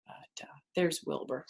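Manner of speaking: hushed whisper pointing to picture; whispered aside. Real, not acted. Reader pointing at the picture for kids, whispering. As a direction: whisper it like a secret. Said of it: "whisper aside to children".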